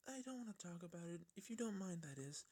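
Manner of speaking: high, soft and hurried, shying away; timid high voice, rushing past it; bashful pony, quick and soft; shy Fluttershy. Acted.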